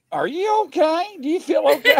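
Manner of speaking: high, worried are-you-okay voice; concerned friend. Retold or imitated. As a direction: fuss over a friend anxiously. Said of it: "keep seeing Shadow going".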